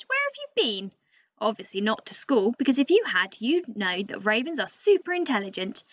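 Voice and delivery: a chatty, know-it-all bird telling the tale with lively swings in pitch; cheeky raven storyteller. Acted. Teacher reading to Year Three as the boastful raven narrator, lively and cheeky. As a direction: read it as a show-off raven talking straight to the kids.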